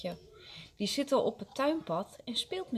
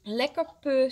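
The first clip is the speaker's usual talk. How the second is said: loud, raised-pitch teasing; childish taunt; 4 semitones higher, 6 dB louder than usual. A narrator's reading. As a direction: tease a rival child. Boy taunts the crying child.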